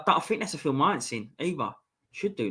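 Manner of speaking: usual speaking voice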